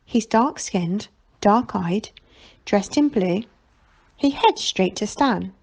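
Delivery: ordinary delivery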